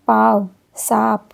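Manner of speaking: सांप said slowly with the vowel through both nose and throat; teacher stretching the nasal vowel; slow nasalized demo. Shown as a demonstration. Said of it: "अनुनासिक में नाक और गले दोनों से".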